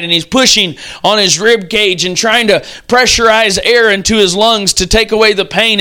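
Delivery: usual speaking voice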